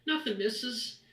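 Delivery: put-on high little boy, hiding something; evasive child. The halfling boy lying to Bertha, high and nervous. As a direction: fib nervously in a small voice.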